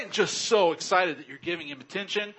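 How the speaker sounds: normal talking voice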